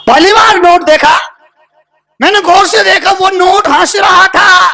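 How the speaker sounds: high, ringing declamation; sermon cry, pitched right up; impassioned preaching. Real, not acted. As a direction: proclaim it to the congregation on a high held pitch.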